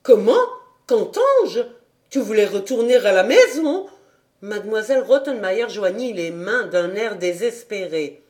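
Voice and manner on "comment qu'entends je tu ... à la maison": shocked governess. A narrator's reading. Governess hearing the child wants to go home, outraged. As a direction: gasp in scandalized outrage.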